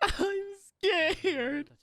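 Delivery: high whiny falsetto fear; hammed-up scared whimper, half laughing; mock scared. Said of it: "I'm so scared of her".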